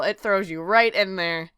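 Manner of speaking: ordinary talk, nothing marked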